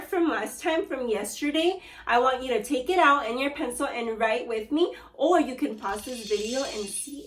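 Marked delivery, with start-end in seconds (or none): none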